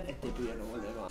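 low, soft worried murmur; slow quiet aside of doubt; hushed inner thought. Retold or imitated. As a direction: mutter it as if only thinking it. Inner thought of waiting friends.

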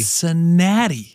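high, slow, drawn-out Cincinnati; teasing echo of the city name; mock drawl. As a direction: stretch it out teasingly. Mocking the trip destination.

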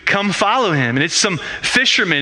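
usual speaking voice

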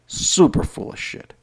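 hushed whisper, no voice at all; whispered jab. Real, not acted. After shouting, he whispers the verdict to Christians. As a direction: drop to a whisper for the punchline.

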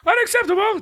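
high, shrill-pitched mock outrage yell. Imitating the manager yelling at the rival, hammed-up outrage. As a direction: ham up the manager's tantrum. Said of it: "Drake just yells".

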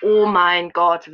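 slow, loud drawn-out squeal. Real, not acted. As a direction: stretch it out, gushing loudly. Finally got the skin.

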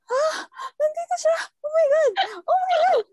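breathless high shrieking fangirl squeal; hyperventilating. Retold or imitated. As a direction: scream it like you've lost control. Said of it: "para ako nag-hyperventilate".

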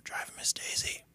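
unvoiced whisper; a friend whispers the title. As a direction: breathe the title.

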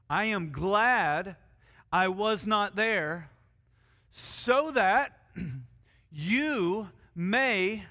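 slow, drawn-out reading with long pauses; solemn scripture reading. Acted. Pastor reading Jesus' words to the congregation, slow and solemn. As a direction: let each phrase of Jesus land slowly.

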